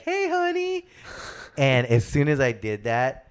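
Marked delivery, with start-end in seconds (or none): 0.0-0.8 high mock sweet wifely call. Pretending to be Brad's fiancée, mock sweet. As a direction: greet him like his fiancée would, falsetto. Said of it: "And I'll be like".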